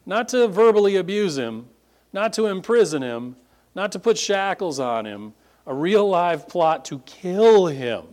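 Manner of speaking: building insistence that ends on a real plot to kill him; emphatic build. Real, not acted. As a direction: press each not, land hard on kill.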